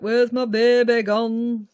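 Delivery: loud, drawn-out northern wail; mock lament in a broad northern accent; northern mum. Mum lamenting her grown-up daughter, loud stretched northern accent. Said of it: "I don't know why I go northern".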